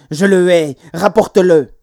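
put-on loud, resentful child; angry protest. A narrator's reading. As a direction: throw it out loudly in defiance.

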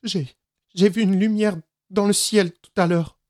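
put-on high, stammering child, mild sobs; a touch of a sobbing little boy. A narrator's reading. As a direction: stammer it through tears. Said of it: "La voix d'Anjo était encore secouée de petits sanglots".

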